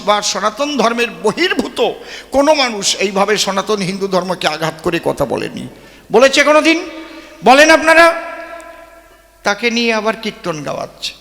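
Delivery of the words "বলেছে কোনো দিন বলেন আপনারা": louder and higher, demanding an answer; rising indignant call to listeners; challenging shout. Real, not acted. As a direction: throw the question hard at the crowd. Challenging the audience.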